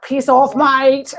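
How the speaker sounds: exaggerated Aussie larrikin impression; hammed-up Australian accent; Steve Irwin. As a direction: put on a caricature Australian voice. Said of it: "Everyone thinks of Steve Irwin".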